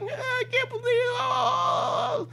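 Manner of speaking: very high, slow mock crying; spoiled brat wail. Spoiled driver saying her stuff to the cop, mock whining wail. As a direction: stretch it into a squealed fake sob. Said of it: "nothing but a little spoiled brat".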